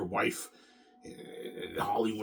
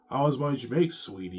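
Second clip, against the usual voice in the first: put-on eager girl voice; 4 semitones higher, 6 dB louder than usual. Voicing the girl again.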